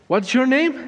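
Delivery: high, insistent demand; sharp repeated question. Retold or imitated.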